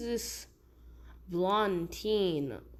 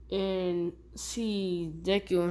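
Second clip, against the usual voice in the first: ordinary delivery, nothing marked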